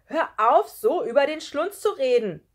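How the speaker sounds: loud, high, bold protest; brave shout. A narrator's reading. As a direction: speak up boldly at full voice. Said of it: "rief er mutig".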